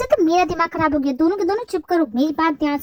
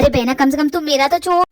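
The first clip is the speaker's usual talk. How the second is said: ordinary talk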